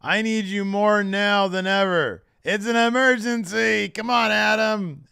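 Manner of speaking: high, loud, wailing plea; melodramatic mock pleading. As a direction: beg desperately, hammed up and drawn out. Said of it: "he's like".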